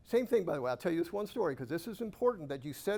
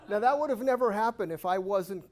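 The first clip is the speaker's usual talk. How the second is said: laughing; 5 semitones higher, 7 dB louder than usual. Real, not acted. Still laughing after the joke.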